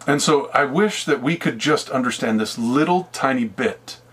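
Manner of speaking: usual speaking voice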